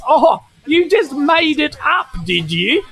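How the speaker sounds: mock him with a sneer; sarcastic mockery. Chad the magpie mocking Doug, sarcastic sneer. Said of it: "Chad is like".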